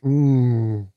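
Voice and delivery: savouring a cold drink; while sipping a drink. Real, not acted. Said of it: "Oh, das ist geil".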